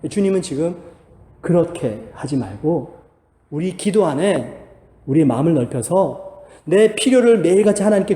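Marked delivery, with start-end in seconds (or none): none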